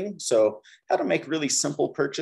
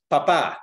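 paPA, second syllable louder and drawn out; stress on last syllable; 2 semitones higher, 5 dB louder than usual. Shown as a demonstration. Teacher repeats papa to show the difference, stress on last syllable. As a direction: land hard on the end of papa. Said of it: "difference between papa and papa".

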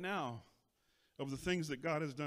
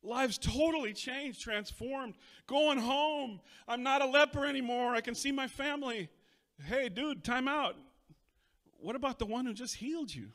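cured leper celebrating; 9 semitones higher, 6 dB louder than usual. Acted. Preacher voicing a healed leper heading home, elated and loud. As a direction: play the healed man rushing home overjoyed. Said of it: "Don't be like those nine guys".

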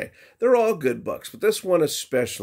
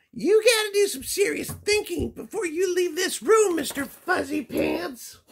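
very high mother voice calling out his nickname; yelling mama. Acted. Mama cat yelling a lecture at her son. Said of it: "she's yelling at him".

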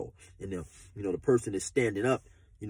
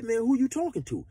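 defiant retort; 10 semitones higher than usual. Retold or imitated. Retold standoff reply.

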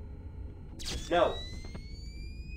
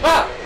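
short, sudden shriek of fright; one quick burst of alarm; startled scream; 11 semitones higher, 15 dB louder than usual. Real, not acted. Player hit by a jump scare, startled scream.

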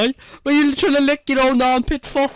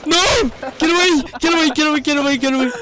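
higher, urgent repeated warding-off cries; playful panic; 4 semitones higher than usual. Pretend. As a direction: yell to drive it away from you. Fending off a gun in game.

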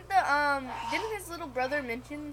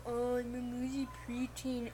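imitating a preteen; 3 semitones lower, 9 dB quieter than usual. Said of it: "the boy who's like".

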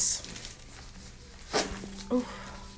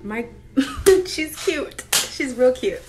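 usual speaking voice

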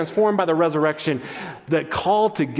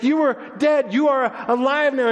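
high-pitched, astonished relief; amazed joy; 9 semitones higher than usual. Acted. Woman hugging the teacher she saw dead, amazed joy. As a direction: exclaim it high, overwhelmed to have them back.

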